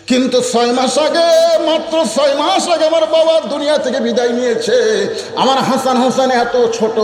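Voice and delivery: high, sustained chanted lament; preaching lament. Acted. Fatema telling of her father's death, high chanted lament. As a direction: raise it into a mournful chant.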